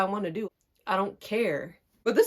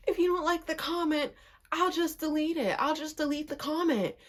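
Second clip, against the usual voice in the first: higher, softer mimicked voice; backing down; 6 semitones higher, 4 dB quieter than usual. Imitating the cousin backing down on the phone, meek. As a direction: meekly give in, imitating her. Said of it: "she's eventually backing down".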